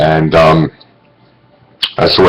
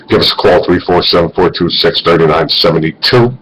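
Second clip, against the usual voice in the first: ordinary delivery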